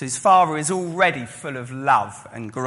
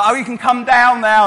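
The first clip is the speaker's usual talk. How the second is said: higher, louder mock mum voice; forgiving mum; 8 semitones higher, 9 dB louder than usual. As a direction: release him with a lilt. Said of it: "She's like".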